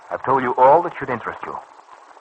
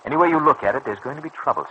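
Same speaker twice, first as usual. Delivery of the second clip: ordinary delivery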